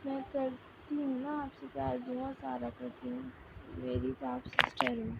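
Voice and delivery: soft, teary pleading; a little girl crying as she comforts her sister; नरम, रोती हुई आवाज़; tearful child. A narrator's reading. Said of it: "खुद भी रोना शुरू कर चु".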